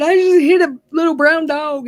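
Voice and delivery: high-pitched imitation of a distraught girl; retold tearful confession. Crying girl confessing to Daddy on the porch, imitated tearful, high voice. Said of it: "this girl standing on the porch crying".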